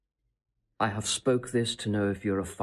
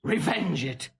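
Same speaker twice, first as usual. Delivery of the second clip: much higher, heated and insistent; impassioned tirade; 11 semitones higher than usual. Acted. Seducer whips up the princess against her husband, soaring and heated. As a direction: work her up, climbing high with outrage.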